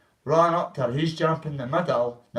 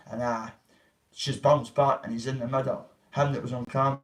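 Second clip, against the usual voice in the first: ordinary delivery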